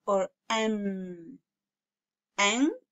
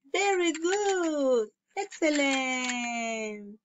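slow drawn-out praise; much slower than usual. Shown as a demonstration. Teacher praising young students.

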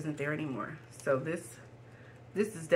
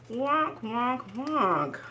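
sad trombone; 5 semitones higher, much slower than usual. Acted. Failed pull sound effect.

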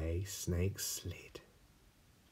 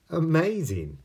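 amazed gruffalo; 10 semitones higher, 10 dB louder than usual. Acted. Gruffalo impressed.

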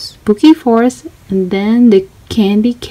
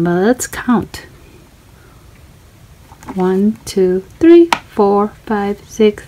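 ordinary delivery, nothing marked

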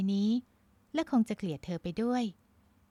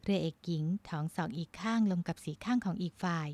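ordinary delivery, nothing marked

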